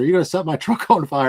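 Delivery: laughing as he asks the crew about the truck; laughing question. Real, not acted. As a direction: chuckle through the question. Laughing while asking film crew.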